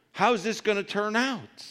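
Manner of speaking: high, loud anxious question; mock anxious cry, pitched high; worried wondering. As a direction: fret aloud like a nervous believer. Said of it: "and we wonder".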